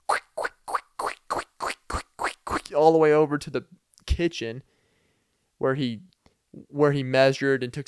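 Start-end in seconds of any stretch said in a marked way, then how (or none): 0.1-2.7 whispered squeaky step sounds; voiceless, repeated little footstep noise; imitated footstep noises. Barefoot visitor crossing to the kitchen, imitated footstep noises.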